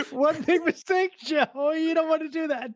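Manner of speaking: very high, laughing voice; amused mock warning. Co-host warning Joe off the plan, high and laughing. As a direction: squeal the warning through giggles.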